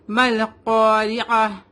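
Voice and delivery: مل قاریعہ slow, م joined to ل, heavy ق; drawn out: مل قاریعہ with merged mal and heavy qaf; tajweed model. Shown as a demonstration. Teacher shows the student how to join the letters, slow demo. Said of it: "میم کو لام سے ملائیں گے".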